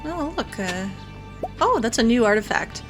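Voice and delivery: usual speaking voice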